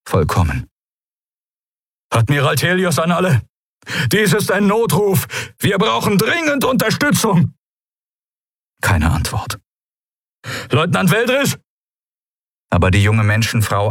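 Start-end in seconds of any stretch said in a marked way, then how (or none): 2.1-7.6 high-pitched emergency broadcast; admiral's raised-voice SOS; urgent distress call. Acted. 10.7-11.6 high, urgent summons; commanding call by rank and name; sharp call. Acted. Admiral calls to frozen lieutenant.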